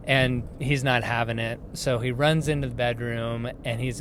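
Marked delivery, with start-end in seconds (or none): none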